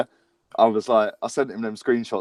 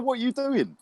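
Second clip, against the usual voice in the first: incredulous outburst; 12 semitones higher, 3 dB quieter than usual. Retold or imitated. Outraged at the ref's missed foul.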